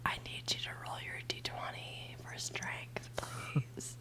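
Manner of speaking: soft unvoiced ASMR whisper. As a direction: whisper gently into the mic. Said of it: "A S M R D and D".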